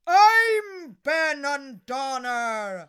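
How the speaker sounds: loud slow menacing giant voice; slow giant boom. Acted. Giant announces himself to Una, slow and loud. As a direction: draw it out like a huge giant.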